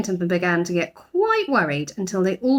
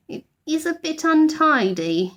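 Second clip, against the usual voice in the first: sheepish admission; 8 semitones higher than usual. A narrator's reading. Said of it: "he admitted".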